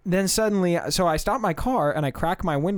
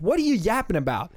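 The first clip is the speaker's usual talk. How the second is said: mock confrontational challenge, pitched up; 5 semitones higher than usual. Chicagoan to a yelling stranger, confrontational. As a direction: imitate someone squaring up to a yeller. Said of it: "the person from Chicago looks at them".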